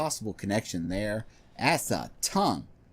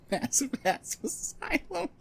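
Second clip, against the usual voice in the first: high giggly voice; giggling through the words; being tickled. Real, not acted. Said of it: "that's tickling me so much".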